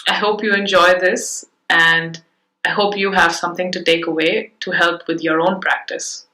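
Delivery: ordinary talk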